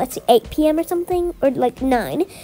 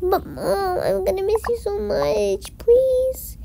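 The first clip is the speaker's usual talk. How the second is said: put-on high, begging little kid clinging to mom; pleading child voice; 6 semitones higher, slower than usual. Child begging mom not to leave, high and pleading. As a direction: beg her not to go, voice climbing high.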